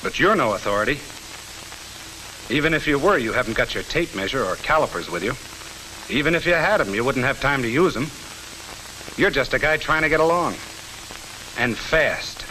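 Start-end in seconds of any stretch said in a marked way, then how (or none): none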